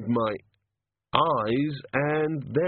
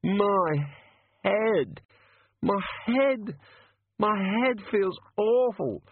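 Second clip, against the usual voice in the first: higher, slow pained groans; groaning in pain; 9 semitones higher, slower than usual.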